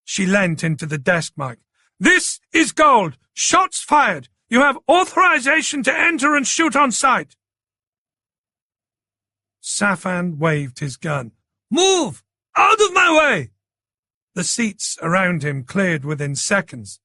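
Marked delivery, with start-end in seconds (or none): 2.0-7.4 urgent command shout. A narrator's reading. Commander ordering armed officers in. 11.7-13.5 gunman's shout. A narrator's reading. Gunman clearing the crowd.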